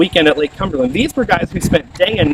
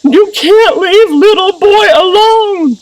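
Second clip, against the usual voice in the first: put-on very high, loud, drawn-out child's wail; hammed-up little boy cry; 14 semitones higher, 5 dB louder, much slower than usual. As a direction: imitate a small son wailing in protest. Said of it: "he'll start crying out".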